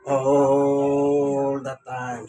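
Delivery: very slow, stretched-out phrase; drawn-out response. Real, not acted. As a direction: stretch out each word like a congregational response. Answers own "God is good. (hinihila nang mahaba ang bawat salita)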